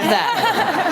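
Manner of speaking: said through a laugh, high and slow. Real, not acted. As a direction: laugh while saying it, delighted. Theme park motivation amuses host.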